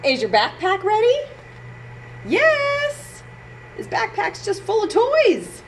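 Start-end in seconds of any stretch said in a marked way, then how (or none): 2.3-3.2 put-on high little kid's yes; child's voice. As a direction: answer like a small boy. Child's answer.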